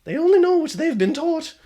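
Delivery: the ghost shrugging off her fate; high wistful ghost girl. Acted. Ghost girl forgives them.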